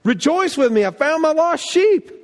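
shepherd calling friends to celebrate; joyful shepherd. Retold or imitated. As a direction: shout the good news to the neighbours.

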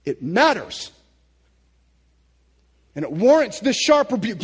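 loud, driving preacher voice. Acted. Preacher scolding modern views of Jesus, loud and driving. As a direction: press the point home hard.

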